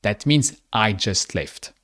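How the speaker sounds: ordinary talk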